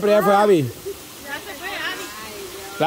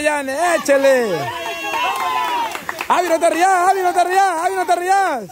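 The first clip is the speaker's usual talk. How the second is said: higher-pitched, repetitive sideline urging; urgent cheering on; 5 semitones higher, faster than usual. Real, not acted. Host urges Yane to keep pouring, excited cheering. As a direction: egg the players on, phrase after phrase.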